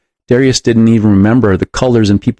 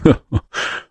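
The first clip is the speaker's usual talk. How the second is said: soft, low-key chuckle; 5 dB quieter than usual. A narrator's reading. Big man amused by Darius's question, soft chuckle. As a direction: chuckle softly to yourself. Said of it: "The big man chuckled".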